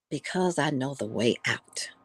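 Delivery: whispered, knowing answer; quietly knowing whisper of the wise friend; hushed reply. Acted. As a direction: let the secret out softly. Story's punchline, friend answers.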